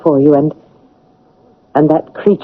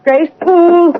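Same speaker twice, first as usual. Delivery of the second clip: high call across the hall; 12 semitones higher than usual. Acted. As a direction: call her name up the stairs. Calling out to open door.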